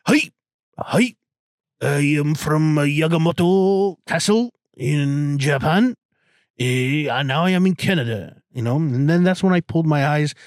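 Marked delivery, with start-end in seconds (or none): 0.1-8.4 mock Japanese. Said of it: "And I'm like".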